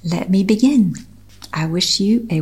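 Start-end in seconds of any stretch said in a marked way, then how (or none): none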